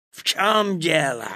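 angry Wolf. A narrator's reading. Wolf halting to question Petya, angry loud voice. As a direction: demand it nastily as a wolf. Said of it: "злобно спросил он".

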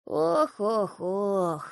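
heavy acted sigh. A narrator's reading. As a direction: sigh out each oh in weary dismay. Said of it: "вздохнула Василиса".

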